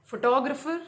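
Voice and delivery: teacher showing the stress shift onto "टो" in photographer. Shown as a demonstration. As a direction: punch the second syllable "टो" in photographer. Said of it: "shift हो गया".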